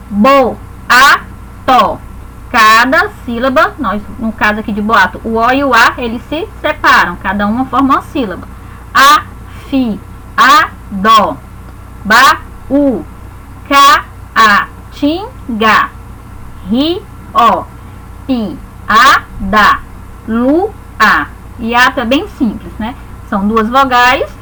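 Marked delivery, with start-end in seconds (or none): 0.1-2.0 Bo, a, to spoken as separate syllables with pauses between. Shown as a demonstration. Teacher separating boato into syllables for students, slow and spaced. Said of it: "exemplos, já separados". 8.9-21.3 Bo, a, to spoken as separate syllables with pauses between. Shown as a demonstration. Teacher separating boato into syllables for students, slow and spaced. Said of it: "exemplos, já separados".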